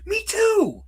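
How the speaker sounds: imitating an eager yes, jumping up high; excited. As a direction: chirp it out all excited and high. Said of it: "Jeff Garlin all excitedly is like".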